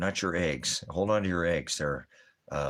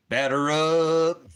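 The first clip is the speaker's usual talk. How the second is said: 1 announcer call; 10 semitones higher, 8 dB louder, much slower than usual. Pretend. Announcer call at ball game.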